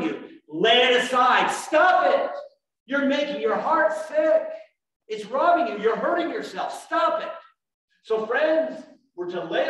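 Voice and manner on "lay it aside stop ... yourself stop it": louder, higher, forceful rebuke; stern command. Real, not acted. Pastor ordering the congregation to lay it aside, loud and stern. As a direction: stop them cold, like a father scolding.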